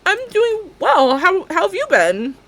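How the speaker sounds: high, slow and careful; awkward polite reply. Retold or imitated. Stylist answering a stranger politely, high and careful. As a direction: stretch out a polite hello. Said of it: "And I'm like".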